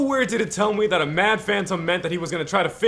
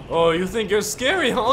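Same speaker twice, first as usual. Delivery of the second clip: ordinary talk, nothing marked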